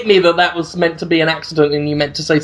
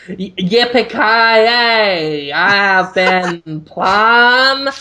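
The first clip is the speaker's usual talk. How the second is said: slow, drawn-out mock American cowboy; American accent; much slower than usual. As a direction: ham up a Texan cowboy, slowly and lazily. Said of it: "That's your American accent?".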